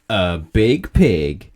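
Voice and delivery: booming slow sorcerer verdict; wizard's threat. Acted. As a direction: stretch out the doom. Wizard's punishment.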